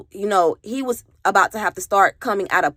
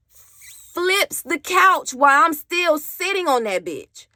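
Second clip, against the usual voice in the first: higher, slow emphatic telling; shocked; 8 semitones higher, slower than usual. Real, not acted. Telling viewers how he flipped the couch, slow and shocked. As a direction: stretch it out in disbelief.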